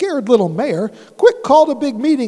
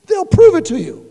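desperate plea; 8 semitones higher, 8 dB louder than usual. Acted. Horton pleads desperately.

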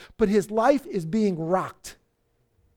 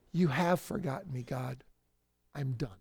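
low, soft resignation; quiet giving up; 8 semitones lower, 9 dB quieter than usual. Acted. People in a trial deciding about God, low resigned quiet. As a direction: let it sink away, finished with God.

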